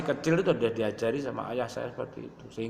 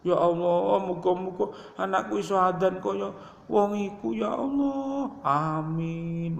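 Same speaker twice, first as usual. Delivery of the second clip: higher, breaking tearful plea; crying prayer; 7 semitones higher than usual. Retold or imitated. Preacher voicing his mother weeping as she prays, pitch climbing high. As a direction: rise into a sob on the final Allah. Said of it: "nangis ibuku".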